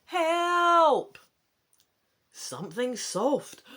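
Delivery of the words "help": much higher, much louder scream; screaming for help. Acted. Boy screaming for the guards, all-out scream. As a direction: scream for help at full force. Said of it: "scream as loud as you can".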